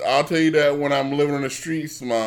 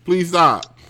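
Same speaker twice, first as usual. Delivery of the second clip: louder, higher, hurt outburst; raised voice, pleading to be left alone; upset protest; 7 semitones higher, 7 dB louder than usual. Real, not acted. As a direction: push back loudly, fed up. Upset protest at being criticised.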